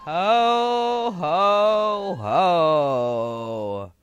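a hint of jolly Santa laugh; a light Santa ho ho ho, fading low. Shown as a demonstration. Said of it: "do your best version of".

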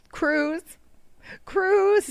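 high, drawn-out calm-down voice; brother saying cruise. Retold or imitated. Brother telling upset sister to relax, high and drawn out. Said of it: "he was like".

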